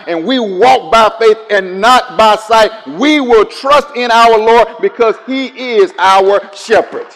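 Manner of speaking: loud, high, slow preaching; rising preaching. Acted. Preacher driving home trust in God, loud and slow. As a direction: build it like a sermon peak. Sermon climbs to a peak.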